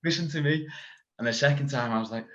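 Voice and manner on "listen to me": higher, lightly voiced stern coach; a mock sharp coach cutting him short; coach telling him off. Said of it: "she's like".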